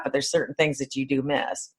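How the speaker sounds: usual speaking voice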